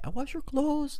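high, polite nanny voice. Retold or imitated. Imitating the nanny handing over his shirt, high and polite. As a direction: lift it high and sweet, very polite. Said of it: "like, very polite".

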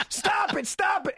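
high, shouted stop it, laughing; hammed-up panicked yelling; mock yell. Husband at the checkout yelling at his wife to stop. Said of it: "he starts yelling".

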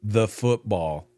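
each word drawn out for weight; slow, deliberate. Real, not acted. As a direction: give the answer slowly, word by word. Blunt answer to host's question.

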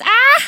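high shrieking cackle; witch laugh. Retold or imitated. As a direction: cackle like an old hag. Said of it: "she's doing her big witch laugh".

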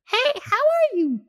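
put-on very high, squeaky kid greeting; nervous blurt. The scared kid blurting to the old woman, very high. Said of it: "I straight up said".

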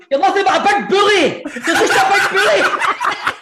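high, laughing taunt; mock shouting. Mate shouting from behind a shut door, mock-indignant yell. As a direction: holler it like an outraged schoolkid, hammed up. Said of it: "Dale's feel shouting".

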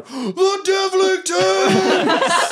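very high, long-stretched cheer; drawn-out high mock roar; crowd calling back. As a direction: imitate a distant crowd taking up the cry. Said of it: "you hear from the crowd".

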